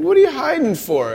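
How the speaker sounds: high-pitched puzzled questioning; puzzled God. Retold or imitated. God to the pair hiding in the bushes, puzzled. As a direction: ask it high, in mock bewilderment.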